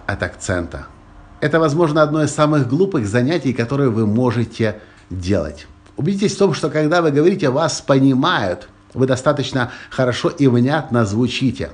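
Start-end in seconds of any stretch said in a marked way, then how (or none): none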